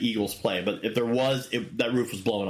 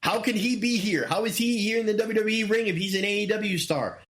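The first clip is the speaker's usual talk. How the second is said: higher pitched mock confusion; imitating bewildered fans in a raised voice; stunned fans; 8 semitones higher than usual. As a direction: ask it like a baffled fan. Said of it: "They're like".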